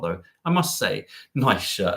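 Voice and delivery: ordinary talk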